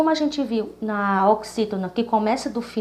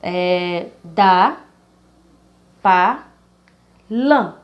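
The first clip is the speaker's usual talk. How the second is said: é, da, pa, lã, each syllable said alone and loud; syllables counted back; 4 semitones lower, 4 dB louder, much slower than usual. Shown as a demonstration. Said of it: "vamos começar do final". (sílabas separadas, fortes, do final ao início)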